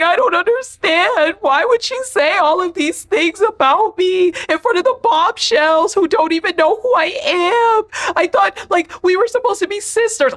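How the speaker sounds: exaggerated weepy impression; mock crying Huda. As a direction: whine it like a crybaby friend. Mocking Huda crying to Shelly.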